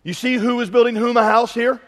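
ordinary delivery

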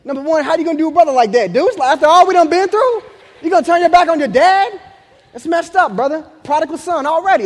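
high, loud, mock-indignant complaint; betrayed dad. Dad to baby who prefers mom, hammed-up high indignation. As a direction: whine loudly as if deeply wronged.

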